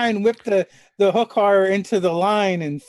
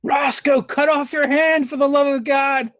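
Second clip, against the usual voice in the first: slow, raised-pitch in-character holler; exasperated plea called across to a companion; yelling out; 5 semitones higher, slower than usual. Acted. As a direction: holler it out, stretching the words. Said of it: "I hear him yell out".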